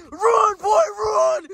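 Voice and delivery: loud, frantic yell to run; urgent shout. Real, not acted. Gamer urging the fleeing toy to run, frantic shout. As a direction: scream at him to get moving. Urging Huggy Wuggy to flee.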